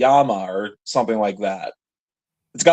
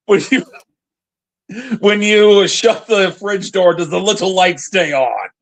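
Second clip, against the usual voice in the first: mock profound question; 6 semitones higher than usual. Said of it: "somebody made it to be".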